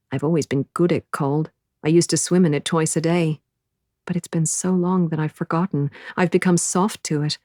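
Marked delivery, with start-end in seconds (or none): none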